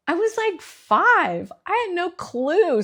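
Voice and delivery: exasperated. Real, not acted. Stressing how young she was.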